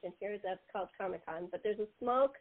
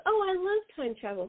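enthusiastic; 8 semitones higher than usual. Retold or imitated. Dorothy's enthusiastic quote.

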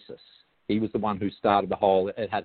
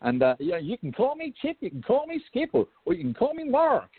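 mock American voice; 10 semitones higher than usual. Teasing his American friends, a high hammed-up American voice. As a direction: play up a cheesy American greeting. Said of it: "And, and I say".

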